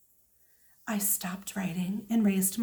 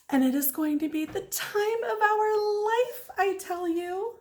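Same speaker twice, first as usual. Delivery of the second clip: put-on high, bright-eyed kid voice bragging; excited little girl; 10 semitones higher than usual. A narrator's reading. First grader boasting in her journal, excited.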